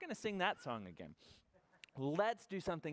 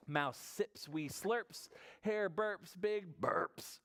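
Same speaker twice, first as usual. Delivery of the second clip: slow, playful read-aloud; storybook narration; 6 semitones higher, much slower than usual. A narrator's reading. Storyteller reading about Mouse and Hare, slow and playful. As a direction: act out each sound word for small children.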